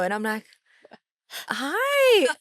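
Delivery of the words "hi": 1 squealed hi. Retold or imitated. Starstruck greeting.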